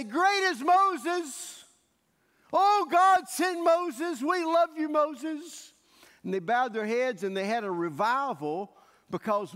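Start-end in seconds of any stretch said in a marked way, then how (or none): 0.0-5.7 high, loud, slow adoring chant; crowd praise. Retold or imitated. Hebrews cheer Moses after his signs, loud high adoring chant. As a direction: hail him like a worshipping crowd.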